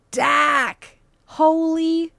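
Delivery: loud, high excited yell of a friend's name; thrilled streamer greets a chat friend; excited shout. Real, not acted. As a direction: shout with delight at a friend's arrival.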